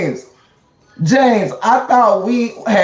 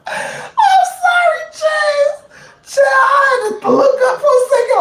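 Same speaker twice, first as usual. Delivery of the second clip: high, teary laughing apology, slow at first; helpless high laugh; crying laughter. Real, not acted. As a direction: gasp out the words through laughter. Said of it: "laugh till I teared up".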